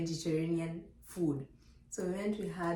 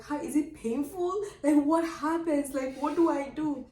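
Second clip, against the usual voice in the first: higher, pressing string of questions; rapid-fire worried asking, pitched up; urgent questioning; 8 semitones higher, 4 dB louder than usual. Retold or imitated. First-time mother quizzing friends about birth, urgent questioning.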